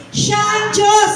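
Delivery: high, slow, forceful proclamation. Shown as a demonstration. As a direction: push it out for the crowd to echo. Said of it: "Lakasan mo pa uli".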